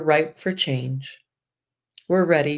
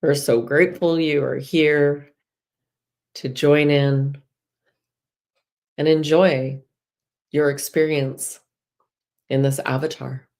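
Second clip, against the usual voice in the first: guided meditation. Acted. Guide leading listeners through breath and release, soothing. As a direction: ease them gently into the womb visualisation.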